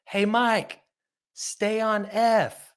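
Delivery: high, drawn-out call across the stage; imitated off-mic call. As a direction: mimic calling to a bandmate, notes stretched out. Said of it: "you can barely hear it".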